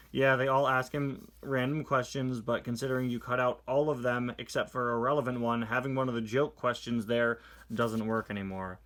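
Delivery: usual speaking voice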